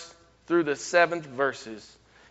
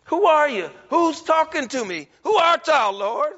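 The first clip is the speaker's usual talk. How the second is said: higher and louder, pressing the question; urgent questioning; 8 semitones higher, 5 dB louder than usual. Acted. Preacher voicing Saul, higher and louder in alarm. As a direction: demand to know who speaks.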